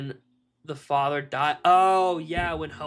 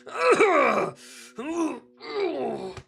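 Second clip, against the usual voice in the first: imitating his own ugly sobbing, pitched way up; mock Viking cry; 10 semitones higher, much slower than usual. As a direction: act out bawling alone in bed. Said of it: "the Viking cry".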